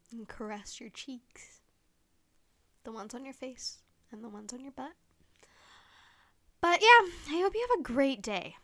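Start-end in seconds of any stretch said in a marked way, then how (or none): 0.1-4.9 whispered as a cheeky fake seduction; mock seductive whisper. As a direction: breathe it like a joking flirt. Joking seductive goodbye to listeners.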